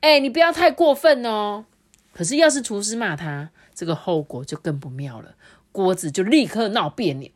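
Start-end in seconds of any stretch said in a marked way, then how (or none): 0.0-1.6 high, loud annoyed scolding; angry cook. A narrator's reading. Cooks scolding the picky pot, angrily. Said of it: "就很生气嘛".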